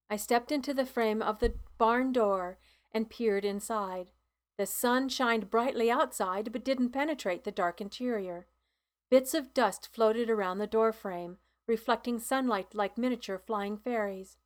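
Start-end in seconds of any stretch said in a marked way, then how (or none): none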